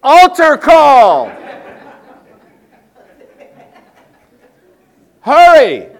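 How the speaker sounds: high, loud, slow shout; mock outburst from the back pew; imagined shout. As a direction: bellow it across the church, half laughing. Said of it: "I almost wanted to stand up at the back and say".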